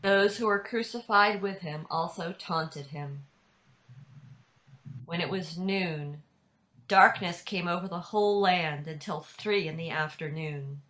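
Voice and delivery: ordinary talk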